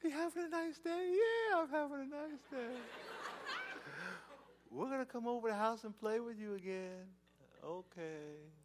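high, soft, singsong cooing; child phone chat. Acted. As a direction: coo back and forth with a small child. Phone chat with little kids.

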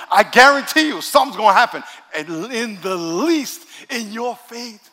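loud, high, emphatic preacher delivery; full-force preaching. Real, not acted. Preacher guaranteeing blessings to those who pray and give, loud preaching. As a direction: drive the guarantee home at full voice.

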